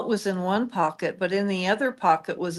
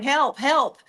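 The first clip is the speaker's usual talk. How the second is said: panicked cry for help; 5 semitones higher, 4 dB louder than usual. Retold or imitated. Faked call to 911.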